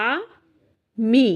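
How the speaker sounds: "আ, মি" in two separate beats; syllable by syllable. Shown as a demonstration. Teacher showing pupils how we say it, syllable by syllable. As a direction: pause clearly between "আ" and "মি". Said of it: "আমরা কিন্তু এইভাবে বলছি".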